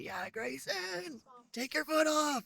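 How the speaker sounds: high, drawn-out imitation cheer; mock sideline yell. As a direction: imitate a crowd yelling from far off, in a high voice. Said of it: "on the sidelines yelling".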